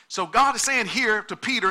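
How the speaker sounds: ordinary talk, nothing marked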